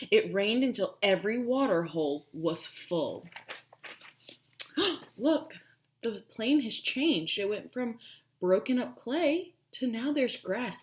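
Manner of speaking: usual speaking voice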